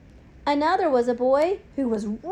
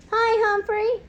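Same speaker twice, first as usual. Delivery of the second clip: small voice; 9 semitones higher, 3 dB louder than usual. A narrator's reading. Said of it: "a small voice said".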